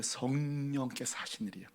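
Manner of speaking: 성령께서 said soft and low, falling quietly; hushed, gentle. Acted. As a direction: murmur 성령께서 like a secret shared. God explains gently.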